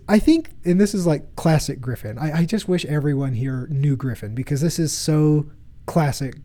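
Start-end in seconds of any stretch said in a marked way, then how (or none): 0.1-0.5 put-on much higher childlike voice; little kid voice. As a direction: pipe up like a small boy. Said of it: "he says".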